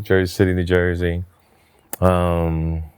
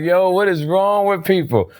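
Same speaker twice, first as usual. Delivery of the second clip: exasperated; 10 semitones higher than usual. Retold or imitated. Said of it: "Oh, I'm like".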